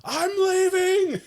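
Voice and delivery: high, drawn-out mock yell; retold yell. Comic blurts out his exit to the group, imitated high yell. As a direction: imitate a sudden high-pitched holler. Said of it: "just yelled".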